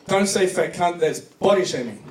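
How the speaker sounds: normal talking voice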